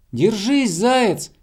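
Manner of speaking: high encouraging call; hedgehog shouting. A narrator's reading. Hedgehog cheering on dangling hare, high shout. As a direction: call up urgently to hang on. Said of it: "крикнул ёжик".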